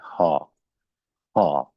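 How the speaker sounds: हा said as open ha; German H sound. Shown as a demonstration. Teacher naming the German letter H aloud. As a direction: say हा with a clear breathy h and long a. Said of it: "German language में alphabet की हद तक pronounce".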